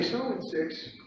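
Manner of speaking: soft-spoken trading call. Retold or imitated. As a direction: call it quietly, holding back. Quiet first take against the shout.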